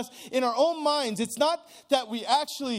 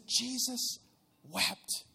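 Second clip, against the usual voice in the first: hushed reading; 8 dB quieter, much slower than usual. Shown as a demonstration. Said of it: "Is that me? Just kidding".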